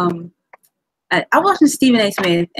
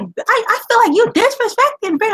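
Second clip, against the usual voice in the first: high-pitched mock offence; worked-up, high-pitched retold protest; retold indignation; 13 semitones higher than usual. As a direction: imitate a pundit taking great offence. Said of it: "And he starts going".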